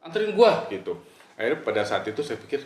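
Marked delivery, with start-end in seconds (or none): 0.0-0.6 high, louder demand; snapping order. Retold or imitated. Friend orders the narrator to drive her, high and curt. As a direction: demand it curtly.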